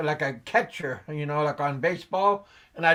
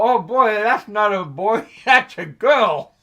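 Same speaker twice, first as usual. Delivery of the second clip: raised, louder voice with a laugh in it; retold surprised correction; 4 semitones higher, 6 dB louder than usual. Father retelling his mix-up over the baby, surprised correction. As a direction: correct yourself loudly, amused at your own mistake. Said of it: "then I go".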